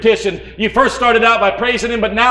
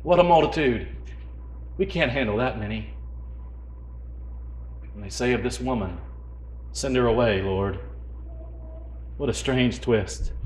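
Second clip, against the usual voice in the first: soft, intimate sermon hush; hushed preaching; 12 semitones lower, 12 dB quieter than usual. Real, not acted. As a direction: pull them in with a hush.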